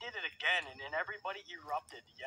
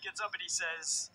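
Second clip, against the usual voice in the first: usual speaking voice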